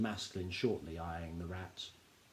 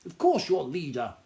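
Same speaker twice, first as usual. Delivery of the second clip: a sharp, impatient snap; snapped; 5 semitones higher, 6 dB louder than usual. Acted. Granny cuts off the whining leader, with a sharp snap. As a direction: bite the words off, a touch louder. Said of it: "snapped Granny Morky".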